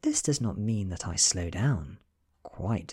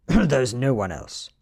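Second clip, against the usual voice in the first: louder, higher, tense protest; frightened insistence; 5 semitones higher, 9 dB louder than usual. Acted. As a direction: insist in panic.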